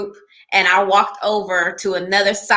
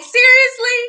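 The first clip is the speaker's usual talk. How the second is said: high, louder disbelieving seriously; incredulous; 13 semitones higher, 5 dB louder than usual. Retold or imitated. Learning who he really is, she reacts in high disbelief. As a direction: throw it out in disbelief. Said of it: "I'm just like".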